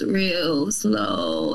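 very slow drawn-out words; imitating slow talk. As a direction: drag it out like him.

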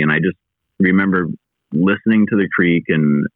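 usual speaking voice

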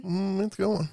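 talking through a swollen mouth. Real, not acted. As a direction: speak with a puffy swollen cheek. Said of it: "You look a little different today".

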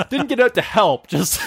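a hint of a gleeful coworker, laughing at the end; amused coworker. Coworker recounts seeing the crash.

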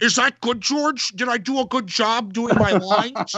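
high, eager actress seeking approval; hammed-up high voice, fishing for praise; Carrie Fisher. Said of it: "then she was like".

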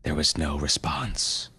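ordinary delivery, nothing marked

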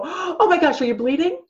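high, loud mock panic; hammed-up alarm over a scraped knee; panicked overreaction. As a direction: gasp it out like an overanxious mom. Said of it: "we don't run over and go".

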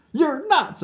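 high, loud, tearful accusation; sobbing outburst. Acted. Tearful Nikita accusing Vlad, as if sobbing. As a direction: wail it at him in upset. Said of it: "I sobbed".

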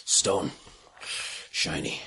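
low, hushed monster voice; soft, deep creature muttering; hungry stone-eating creature. Acted. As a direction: murmur it low like a covetous creature. Game master voices the creature.

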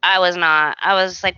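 normal talking voice

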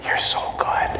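whispered mock-sweet praise, no voice. Shown as a demonstration. Trainer tricking a returning dog with praise, whispered. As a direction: whisper it to the dog, hiding annoyance.

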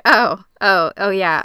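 flustered backpedal. Retold or imitated. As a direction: catch yourself and backtrack.

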